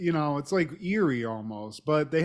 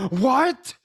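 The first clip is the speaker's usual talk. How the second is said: a high, louder burst of disbelief; incredulous what; 8 semitones higher, 5 dB louder than usual. Real, not acted. Hearing the absurd amateur porn list, a high incredulous blurt. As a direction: blurt it in amused shock.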